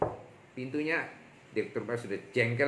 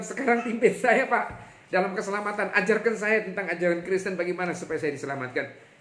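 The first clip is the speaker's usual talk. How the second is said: eager plea; 9 semitones higher, 7 dB louder than usual. Retold or imitated. Director begging for salvation.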